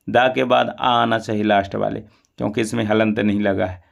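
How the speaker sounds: ordinary talk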